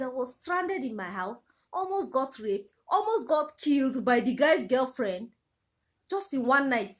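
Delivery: ordinary talk, nothing marked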